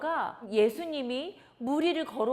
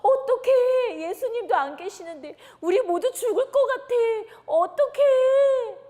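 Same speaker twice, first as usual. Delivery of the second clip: much higher and louder, crying out; terrified wailing; 9 semitones higher, 11 dB louder than usual. Acted. Disciples terrified in the dark storm, wailing. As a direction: stretch out the "어떡해" in a fearful cry. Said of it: "모두 다 무서워서".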